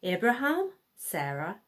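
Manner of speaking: ordinary delivery, nothing marked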